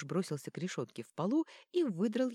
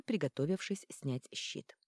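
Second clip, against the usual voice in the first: usual speaking voice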